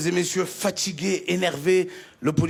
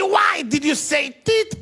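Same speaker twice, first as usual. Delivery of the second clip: slow stern higher question; interrogator; 5 semitones higher, slower than usual. Retold or imitated. Officer interrogates the comedian, slow stern. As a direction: press him slowly. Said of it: "Il m'a dit".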